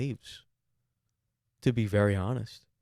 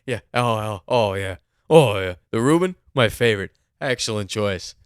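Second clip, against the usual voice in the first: lightly hammed-up approving waiter, louder; mock waiter; 7 dB louder than usual. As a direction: play up a waiter's praise for the order. Exaggerated imitation of waiter's approval.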